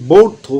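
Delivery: বৌর্থ said loud with a lifted pitch, like ব্যর্থ; বৌর্থ punched out loud to echo ব্যর্থ; pronunciation demo. Shown as a demonstration. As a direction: stress বৌর্থ loudly as a sound hint. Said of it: "এরকম করে আমরা উচ্চারণ করতে পারি".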